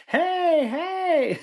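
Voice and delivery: very high, loud hey calls; imitated crowd yelling. Spectators shouting as the ump floors the coach, high and loud. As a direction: call out like a panicked crowd.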